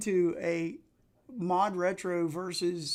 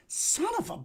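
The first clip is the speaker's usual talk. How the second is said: high-pitched, cut-off curse; real exasperation. Real, not acted. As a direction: yelp it as the game goes wrong. Mishap while playing the game.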